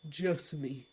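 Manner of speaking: low, soft, falling call of the name; a low, hushed call. Acted. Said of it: "a voice cried out".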